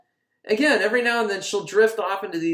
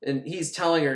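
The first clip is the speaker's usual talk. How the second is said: normal talking voice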